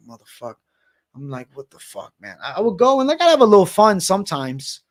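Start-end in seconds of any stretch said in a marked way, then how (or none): 0.1-2.4 a quiet, muttered grumble under the breath; muttered curse. Retold or imitated. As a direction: mutter it like you're annoyed at work. Retold grumbling at work.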